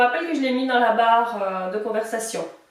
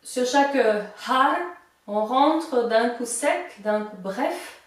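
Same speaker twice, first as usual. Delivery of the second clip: normal talking voice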